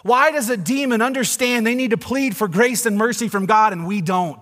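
loud, high proclamation; full-force preaching. Acted. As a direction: drive it home to the congregation.

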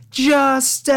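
imitating a triumphant shout; mock triumphant yell. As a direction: shout it like a catchphrase. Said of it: "lately I've just been like".

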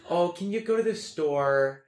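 high, drawn-out Midwestern mom; mom's Midwest accent. Retold or imitated. Mom sending her child to the store, Midwest accent. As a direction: stretch the Midwest vowels like mom asking a favor. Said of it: "My mom's like".

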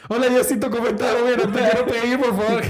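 high, loud comic plea to God; panicked prayer. Retold or imitated. Man being shoved prays to God, hammed-up panicked plea. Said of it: "Y yo".

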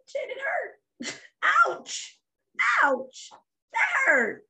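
very high-pitched, hammed-up yelping; mock cry of pain. Child who scraped a knee, crying out in mock pain. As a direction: wail it out like a hurt child.

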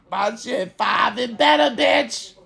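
high shouted hawking; calling out. Acted. Advertising his price to the crowd, high shout. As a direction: hawk it like a street vendor. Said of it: "calling out his price".

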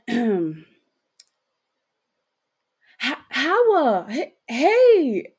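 high, loud, stammered hello; awkward greeting. Acted. Boy greeting a friend awkwardly, high and stammering. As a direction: stumble over an overdone, high-pitched hey. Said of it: "nods his head very awkwardly".

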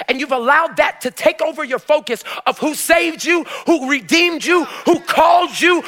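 impassioned preacher in full cry; full-force preaching. Real, not acted. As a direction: build to loud, driving phrases for the congregation.

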